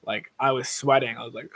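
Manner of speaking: ordinary talk, nothing marked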